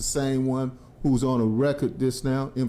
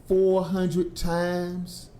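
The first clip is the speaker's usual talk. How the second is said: four hundred times drawn out very slowly; stretching out the number of times he pleaded; slowly stressed; 4 semitones higher, much slower than usual. Real, not acted. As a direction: lean on the number for effect. Stressing the count.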